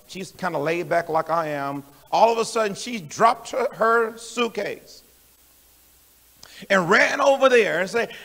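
ordinary talk

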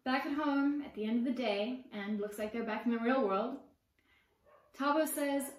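usual speaking voice

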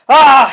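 loud battle shout "Ah!"; a big loud war cry; warrior cry. A narrator's reading. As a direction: bellow it like a warrior charging. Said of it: "he cried".

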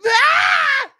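very high squealing laugh; shrieking laugh. Real, not acted. Cracking up at his own bit, shrieking laugh. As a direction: burst into a screaming laugh.

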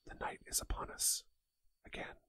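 high, ghostly whisper; spooky breathy sign-off; eerie whisper. Acted. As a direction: whisper it like an omen. Eerie whispered outro line.

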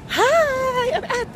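high, loud cheery hello; excited hi. Real, not acted. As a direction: burst in with a bright hi. Bright greeting at airport.

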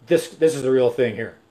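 ordinary delivery

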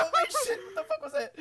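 very high squeal through laughter; laughing disbelief. Real, not acted. Player baffled by a botched move, laughing disbelief. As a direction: crack up, voice shooting up.

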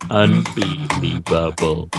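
"Unbelievable" slowly, syllable by syllable; "Unbelievable" broken into slow, separate beats; drawn-out repeat. Shown as a demonstration. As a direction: stretch out every syllable of "Unbelievable".

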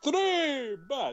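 very high vampire count. Pretend. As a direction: shriek the number gleefully. Count parody echo.

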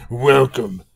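loud, gruff monster king; Bowser voice. Acted. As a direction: shoo him off like a grumpy king. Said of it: "that Bowser voice".

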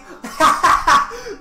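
high, loud burst of laughter; gleeful laugh. Real, not acted. Player laughing at his own running, gleeful. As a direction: crack up loudly. Gloating while running in game.

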